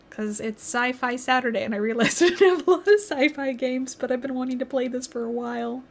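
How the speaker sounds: ordinary talk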